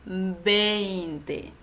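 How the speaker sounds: veinte said slowly, ei clearly gliding. Shown as a demonstration. Teacher modelling veinte to students, clear ei. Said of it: "recuerda que el número se dice veinte".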